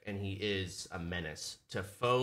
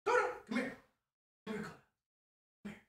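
calling puppy; 13 semitones higher, 5 dB quieter, much faster than usual. Real, not acted.

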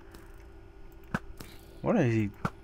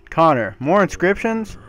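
ordinary talk

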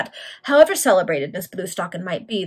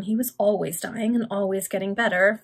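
ordinary delivery

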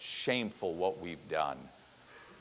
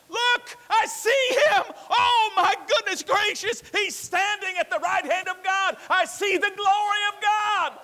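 loud voice; 7 dB louder than usual. Acted. Believer crying out a vision of heaven, ecstatic shout. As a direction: cry it out as if seeing God's glory. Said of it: "say with a loud voice".

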